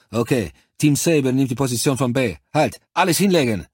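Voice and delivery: loud, high command to drop; shouted order. A narrator's reading. Cortez orders everyone down, loud commanding shout. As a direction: bark the order to hit the ground.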